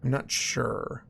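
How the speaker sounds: normal talking voice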